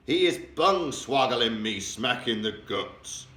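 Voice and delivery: loud, slow, outraged giant shout; the woken giant shouting he was kicked in the guts; angry shout. A narrator's reading. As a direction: bellow it out in fury. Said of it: "shouted the gizzard gulper".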